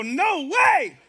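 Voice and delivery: a loud, high no way; surprised shout. Retold or imitated. Pastor shouting back at his friend in disbelief. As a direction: blurt it out in disbelief. His own amazed reply.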